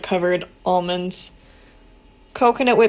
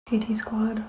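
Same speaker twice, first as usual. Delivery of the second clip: whispered hello; 6 dB quieter than usual. Real, not acted. Vlogger greeting her viewers quietly, whisper.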